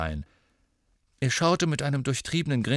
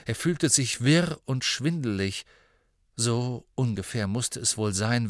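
plain reading voice

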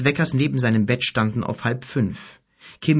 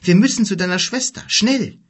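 higher, louder, fast and pressing; urgent; 9 semitones higher, 6 dB louder than usual. A narrator's reading. Mother urging the family to the clinic, urgent and fast. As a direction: hurry everyone out the door.

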